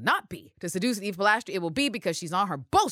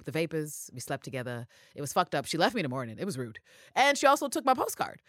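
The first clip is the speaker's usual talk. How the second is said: ordinary talk, nothing marked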